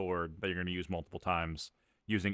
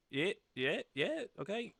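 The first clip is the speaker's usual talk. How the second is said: nervous NPC voice; 10 semitones higher, 4 dB quieter than usual. Acted. GM voicing nervous NPC Bubble.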